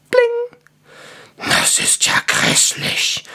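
slow, hoarse dwarf mutter; rough, drawn-out dwarf grumble. A narrator's reading. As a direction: mutter it in voiceless disgust. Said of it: "murmelte der Zwerg".

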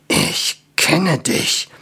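slow unvoiced whisper; slow suspicious whisper. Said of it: "flüsterte er".

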